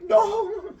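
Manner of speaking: high, loud, drawn-out no; horrified cry. Retold or imitated. Storyteller as Athena finding the girl hanged, horrified cry. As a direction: wail one word in mock horror.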